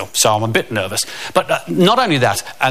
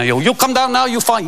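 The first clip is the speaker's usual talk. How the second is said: put-on higher, broken-English Swedish accent; 9 semitones higher than usual. The Swedish buddy calls to him, broken-English Swedish accent. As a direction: Eric checks on his panicking buddy, Swedish accent. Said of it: "And Eric pops up".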